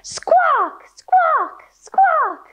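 very high squawking parrot; sharp high parrot squawk. Pretend. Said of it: "let me hear you squawk".

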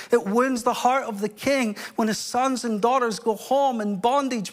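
emphatic, rolling pulpit delivery; impassioned preaching. Real, not acted. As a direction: preach it with urgent force. Preaches at full force.

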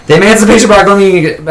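high, rushed eager blurt; eager nerd. Retold or imitated. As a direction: blurt "Emancipation Proclamation" high and fast. Nerd blurting trivia answers.

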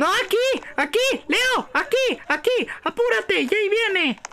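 insistent, rising urgency; urgent calling. Real, not acted. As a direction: call them over before danger arrives. Calling Leo over as danger approaches.

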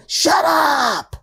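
very high mock yell; imitated brother's retort. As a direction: snap it back as a joke. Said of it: "he'd probably say".

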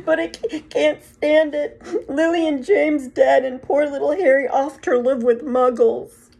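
tearful Hagrid. A narrator's reading. Hagrid weeps over Harry's dead parents, loud and tearful. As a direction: choke it out through tears.

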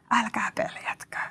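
soft reassuring whisper; breathy hush of comfort. Acted. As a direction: whisper it gently to calm them. Jesus calming frightened disciples.

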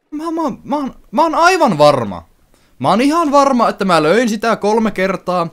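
high loud pitch, slowed words; exasperated insistence. Real, not acted. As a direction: protest the game's unfairness. Insists he hit it three times. (turhautunut vakuuttelu)

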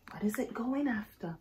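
hushed whisper. Real, not acted. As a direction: whisper to build suspense. Storyteller whispers suspense.

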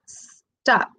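"stop" broken as "s... top"; split into two parts. Shown as a demonstration. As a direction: hold the S apart, then say "top" in "stop". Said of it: "tiene dos sílabas".

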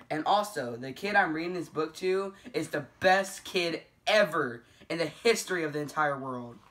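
usual speaking voice

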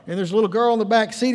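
ordinary talk, nothing marked

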